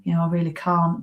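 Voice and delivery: imitated can't-be-bothered whisper; a lightly acted, drained whisper of self-pity; mock weary groan. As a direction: drain all energy out of it. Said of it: "you go".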